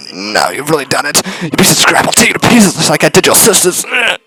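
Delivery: high, strained voice going from threat to begging; a beaten man turning from rage to pleading; thug begging. Acted. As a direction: snarl then plead for mercy. Voicing the thug threatening then begging.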